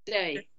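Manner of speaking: "Dirty" with the T almost gone; "Dirty" as DUR-ee, London accent; dropped T. Shown as a demonstration. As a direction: say "Dirty" London-style, swallowing the T. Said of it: "the T is almost not there at all".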